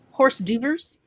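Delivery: hors d'oeuvres mangled into "horse doovers"; Homer Simpson. Acted. Quoting what Homer Simpson says, hors d'oeuvres mispronounced "horse doovers". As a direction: read it literally, "horse DOO-vers", rising at the end. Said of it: "says what Homer Simpson says".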